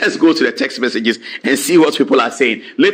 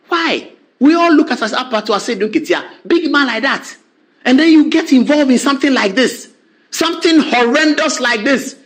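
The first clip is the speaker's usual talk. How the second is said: ordinary talk, nothing marked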